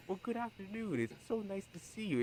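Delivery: high, polite, prim code-switched greeting. Retold or imitated. Mocking her own code-switching, she greets in a high prim voice. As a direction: put on a very proper afternoon-tea voice.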